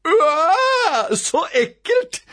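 put-on high, loud, wailing child's howl; howl of disgust. A narrator's reading. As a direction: howl it out, thoroughly grossed out. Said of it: "ulte rampete Robin".

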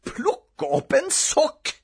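high, slow, drawn-out incredulity; outraged disbelief. A narrator's reading. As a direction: stretch each word in outrage. Boy repeats it, now outraged.

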